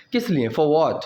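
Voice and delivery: usual speaking voice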